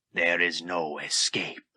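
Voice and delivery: low, slow, soft menacing Vader voice; menacing warning. Acted. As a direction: warn him darkly and slowly. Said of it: "the Dark Lord of the Sith warned".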